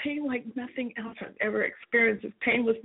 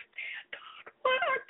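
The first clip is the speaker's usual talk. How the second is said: slow, breathy whisper of a question; 12 semitones higher, 4 dB quieter, much slower than usual. Retold or imitated. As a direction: ask it silently, in a hushed whisper. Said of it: "I asked this voice with my mind".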